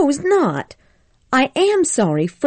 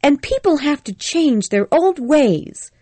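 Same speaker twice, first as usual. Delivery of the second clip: put-on higher, indignant young woman, mildly angry; angry Scarlett; 7 semitones higher, 3 dB louder than usual. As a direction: Scarlett defending herself with a touch of anger. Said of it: "said Scarlett angrily".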